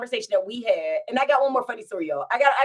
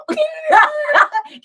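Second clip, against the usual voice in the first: high loud burst of laughter; loud shrieking laugh; 10 dB louder than usual. Real, not acted.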